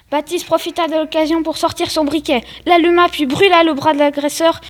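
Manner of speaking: plain reading voice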